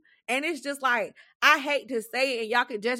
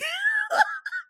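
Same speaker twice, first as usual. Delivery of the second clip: high laughter. Real, not acted. Laughs at own pettiness.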